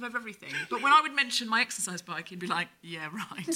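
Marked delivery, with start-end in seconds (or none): 2.8-3.5 low, soft dismissive aside; sceptical husband. Retold or imitated. Husband hearing about the exercise bike, quietly sceptical. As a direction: drop it low and unconvinced. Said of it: "like, slightly".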